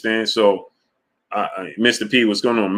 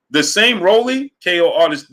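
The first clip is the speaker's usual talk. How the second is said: incredulous outburst; 7 semitones higher, 4 dB louder than usual. Real, not acted. Heated argument about fighters' emotions.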